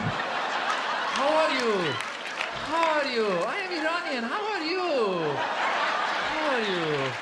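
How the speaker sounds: slow, drowsy Iranian accent; slow Persian accent. Shown as a demonstration. Mocking how Iranians talk, slow sleepy Persian accent. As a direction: drag each word like you're falling asleep. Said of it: "Iranians talk like this".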